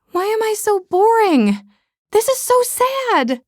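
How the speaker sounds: high, slow self-pitying wail; mock whine. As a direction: whine it high and drawn out, hamming up the misery.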